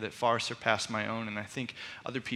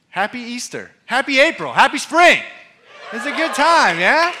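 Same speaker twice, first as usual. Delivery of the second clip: excited; 11 semitones higher, 13 dB louder than usual. Real, not acted. Excited festive greeting.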